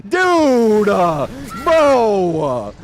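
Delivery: high loud outraged cries, the last one dragged out slowly; exasperated yelling. Real, not acted. At a teammate after the door stays shut, loud exasperated cries. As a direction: shout your disbelief at the failed play. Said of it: "I don't mean to yell at teammates".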